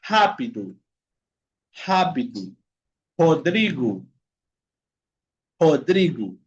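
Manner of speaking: "Rápido", "Rodrigo" said with R as jota, not trilled; initial R as J. Shown as a demonstration. Teacher modelling word-initial Portuguese R, carefully with J sound. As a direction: start "Rápido" and "Rodrigo" with a breath-like Spanish J. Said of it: "la R va a tener un sonido de J".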